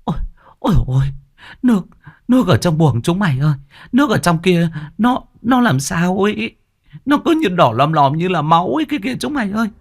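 high, fast, panicked babble; terrified stammer. A narrator's reading. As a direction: stammer out the horror, pitch climbing. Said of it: "ú ớ".

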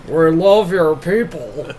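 slow, lightly put-on man's voice; a hint of a gruff guy, drawn out; imitating a man. Friend imitating a man for the podcast, slow mock man voice. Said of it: "'Cause you imitate men a lot".

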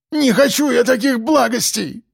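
high desperate shout; крик. A narrator's reading. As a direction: cry out in protest, pitched high. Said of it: "закричал узник". (отчаянный крик)

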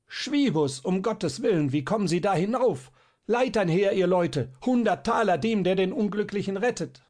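high, loud pleading shouts; desperate shouting. A narrator's reading. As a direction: call the crowd to help, urgently. Schierling keeps shouting to crowd. (verzweifelte, laute Rufe)

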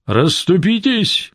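high, slow commanding call; shouting. Acted. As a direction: order the crowd aside. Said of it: "кричал он".

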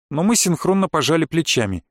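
ordinary delivery, nothing marked

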